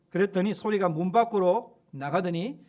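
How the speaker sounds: normal talking voice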